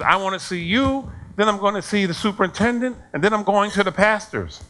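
high, insistent mock tirade; imitated upset parent. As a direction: act out an irate parent laying down threats. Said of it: "Why are you so upset?".

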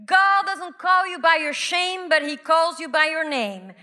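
ordinary delivery

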